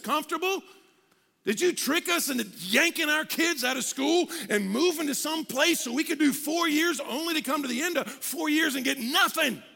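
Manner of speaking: high-pitched accusing outcry to God; indignant like Jeremiah. Acted. Seminary students accusing God of tricking them, indignant. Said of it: "they started acting like Jeremiah".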